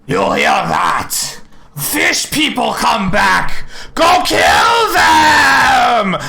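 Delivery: hoarse creature voice rising to a loud, slow bellow; a lizard folk calling the hunt; creature shout. Acted. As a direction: rouse the tribe to hunt the fish people. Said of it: "and they're like".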